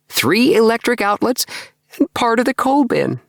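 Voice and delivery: listing his savings with rising delight; a young man perking up, voice lifting; brightened. Acted. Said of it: "and he brightened".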